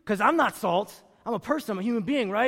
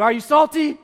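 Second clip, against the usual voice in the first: much louder and higher, rallying the crowd; shouted call; 5 semitones higher, 8 dB louder than usual. Shown as a demonstration. Pastor demanding the congregation answer louder, shouting. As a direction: shout it to get the room going. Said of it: "I can't hear you".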